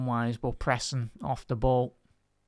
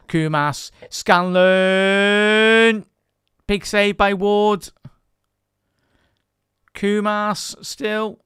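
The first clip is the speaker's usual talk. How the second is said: loud, high-pitched shout as the chance unfolds; excited match commentary; 8 semitones higher, 13 dB louder, much slower than usual. Real, not acted. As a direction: call the attack live, names stretched out loud and high.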